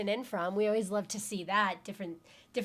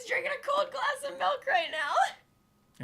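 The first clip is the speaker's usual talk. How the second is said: laughing. Real, not acted. Reads viewer comment, cracking up.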